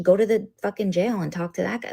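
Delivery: ordinary talk, nothing marked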